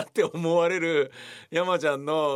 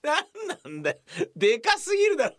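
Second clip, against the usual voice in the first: high, louder mock outrage; incredulous outburst; 14 semitones higher, 3 dB louder than usual. Incredulous outburst at the actor's oversized prop. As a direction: yell in disbelief at the size.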